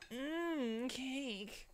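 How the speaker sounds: high, delighted mmm over cake; high savoring voice. Acted. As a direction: coo about the cake up high.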